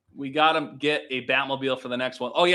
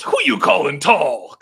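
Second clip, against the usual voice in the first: slow, louder mock Joker retort; 5 semitones higher, 9 dB louder, slower than usual. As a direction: sneer it back slowly. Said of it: "he goes".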